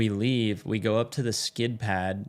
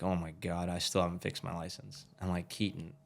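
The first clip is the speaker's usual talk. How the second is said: panicked undertone; 4 semitones lower, 9 dB quieter than usual. Retold or imitated. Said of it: "So I'm like".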